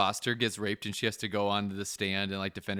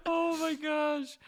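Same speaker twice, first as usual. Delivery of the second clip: laughing; much slower than usual. Real, not acted. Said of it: "laughing shout".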